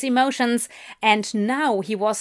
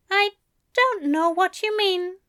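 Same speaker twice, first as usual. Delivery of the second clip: Aziraphale voice; 9 semitones higher than usual. A narrator's reading.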